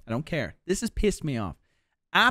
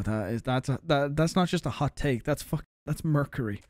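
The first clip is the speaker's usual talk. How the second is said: ordinary talk, nothing marked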